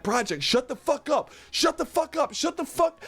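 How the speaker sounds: put-on a father begging his laughing kid to shut up; exasperated dad. Dad on the plane hushing his son, rising in pitch. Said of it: "I'm hearing my dad".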